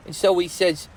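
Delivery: usual speaking voice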